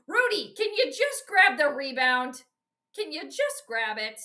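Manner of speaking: fed-up fan yelling at a player; exasperated plea. Real, not acted. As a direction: beg the screen in frustration.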